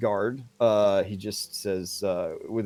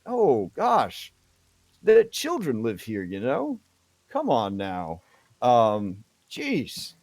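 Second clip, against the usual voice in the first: confused, embarrassed; slower than usual. Acted. Guard reminding visitor children live here, embarrassed. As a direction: protest awkwardly, mildly scandalized. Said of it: "like, really confused and embarrassed".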